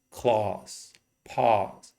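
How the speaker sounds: "claws, paws" for class and pass, British broad A; British pronunciation with a long "ah"; British accent. Shown as a demonstration. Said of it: "that's very British".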